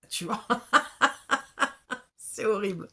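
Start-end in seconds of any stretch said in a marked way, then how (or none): 0.5-2.0 high, loud embarrassed laugh at herself; burst of laughter. Real, not acted. Reader laughing at her own southern accent, loud and high. 2.2-2.9 louder, still laughing, mock horror; laughing groan. Real, not acted. Reader cringing at her accent, laughing. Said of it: "j'aime pas cet accent du sud".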